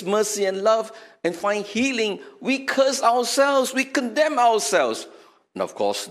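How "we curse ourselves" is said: normal talking voice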